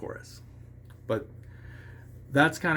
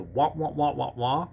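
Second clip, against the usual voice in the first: muted wah-wah like a cartoon teacher; a hint of cartoon teacher wah-wah; Charlie Brown's teacher; 3 semitones higher than usual. Shown as a demonstration. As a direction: lightly mimic the trombone teacher drone. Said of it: "as if Charlie Brown's teacher".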